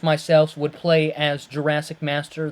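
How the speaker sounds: usual speaking voice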